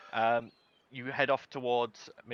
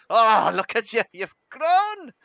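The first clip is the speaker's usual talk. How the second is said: loud, high gushing grandmother; Polly; 9 semitones higher, 10 dB louder than usual. Acted. Grandmother grabbing Xandal's cheeks, loud high-pitched fussing. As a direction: gush loudly, pitch soaring at the end. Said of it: "grabs your cheeks and goes".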